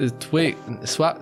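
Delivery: ordinary delivery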